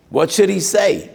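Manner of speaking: high, loud question to the room; loud rhetorical question. Acted. Preacher challenging listeners, high and loud. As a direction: throw the question out.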